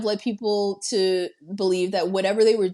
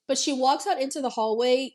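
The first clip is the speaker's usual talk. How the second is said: ordinary talk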